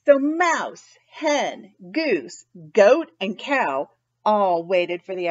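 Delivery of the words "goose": plain reading voice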